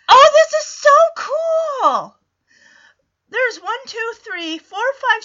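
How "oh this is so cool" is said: delighted, much higher and louder, drawn out slowly; thrilled. Real, not acted. Viewer delighted by kids with turtles, high slow gush.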